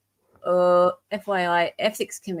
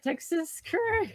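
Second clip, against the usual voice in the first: incredulous repeat; 11 semitones higher, 6 dB quieter, slower than usual. Real, not acted. Repeating the funny name.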